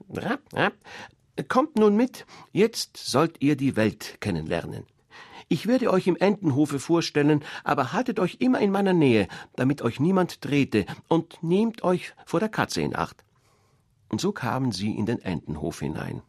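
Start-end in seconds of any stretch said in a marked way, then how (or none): 0.1-0.7 high quacking duck call. Acted. 1.5-13.2 high, loud, bustling mother duck; a proud mother showing off her brood. Acted.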